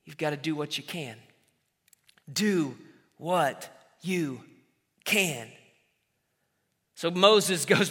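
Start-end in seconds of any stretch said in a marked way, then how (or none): 0.0-1.2 dropped low and soft, almost breathed; soft emphatic sermon point; hushed key point. Shown as a demonstration. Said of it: "the third thing that I want you to understand". 2.3-5.5 each word set apart by a pause, low and soft; deliberate paced emphasis; slow separated words. Shown as a demonstration. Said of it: "the third thing that I want you to understand".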